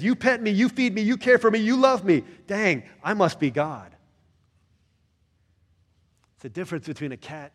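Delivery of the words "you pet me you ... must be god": loud, high, smug cat. Acted. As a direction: proclaim like a cat who thinks it's divine.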